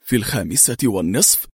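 low-pitched questioning "في الخامسة والنصف", rising at the end; astonished echo. A narrator's reading. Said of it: "هاتفا في دهشة". (ترديد "في الخامسة والنصف" بدهشة وسؤال بنبرة منخفضة تصعد في آخرها)

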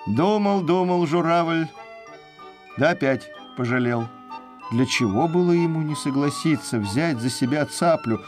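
plain reading voice

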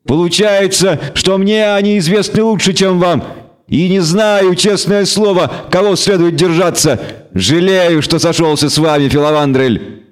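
high-pitched, regretful goat devil; indignant devil. Acted. Devil regretting siding with the elf, indignant. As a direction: protest in a high creature voice.

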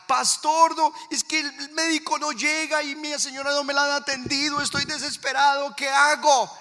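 high, loud frantic plea; imitated desperate man. Desperate man phones his pastor about his sick wife, frantic. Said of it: "el tipo desesperado".

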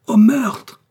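high, loud yell; yelling alarm. A narrator's reading. Patrick sees the duke stabbed, yelling alarm. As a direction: raise the alarm at full voice. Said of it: "hurla Patrick".

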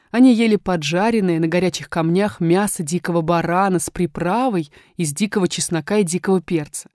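ordinary delivery, nothing marked

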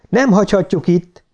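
understated, urgent shout; protesting cry. A narrator's reading. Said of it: "kiáltotta Beck".

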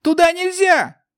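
high frightened shriek; terrified cry. A narrator's reading. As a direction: scream in panic. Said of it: "вскрикнул кто-то из тех".

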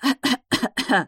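put-on raspy old woman's cough; croaky throat-clearing cough; старческий кашель. A narrator's reading. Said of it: "горло прихватило". (хриплый кашель)

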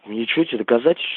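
ordinary talk, nothing marked

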